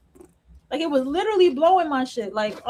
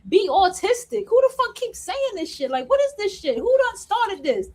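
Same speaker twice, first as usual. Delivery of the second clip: exasperated rant; 6 semitones higher than usual. Retold or imitated. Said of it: "I'm like".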